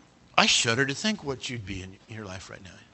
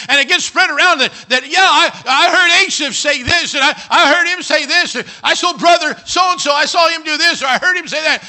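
high, very loud, urgent shouting; full-force, high-pitched tirade; alarmed preacher's shout; 13 semitones higher, 13 dB louder than usual. Acted. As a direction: shout it out as a frantic realisation. Acting Asaph's sudden alarmed realisation.

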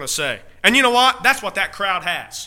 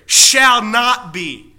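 shall not be, slow and louder, each word hit; stress on shall not be; 2 semitones higher, 7 dB louder, much slower than usual. Shown as a demonstration. Preacher stressing the verse's wording to congregation, slow emphatic shall not be. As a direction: lean hard on shall not be, slowly. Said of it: "the emphasis here".

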